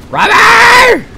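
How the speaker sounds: a very high, loud, drawn-out yell; frustrated shout. Real, not acted. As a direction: scream the word in exasperation.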